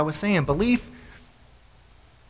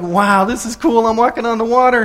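louder, acted-out wonder; delighted amazement; 5 semitones higher, 6 dB louder than usual. Peter out on the water, acting thrilled and amazed.

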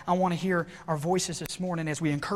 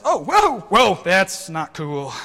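acted alarm; 3 semitones higher, 11 dB louder, much slower than usual. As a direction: play sudden alarm at a leak, drawn out. Acting out noticing the leaking boat.

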